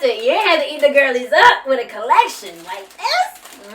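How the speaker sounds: loud, emphatic, swooping gushing; hyped-up praise. Real, not acted. Vlogger praising the makeup brand, loud hyped-up gushing. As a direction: praise them loudly with playful emphasis.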